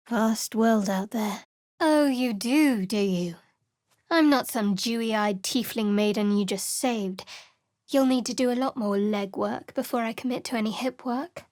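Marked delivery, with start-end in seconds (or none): none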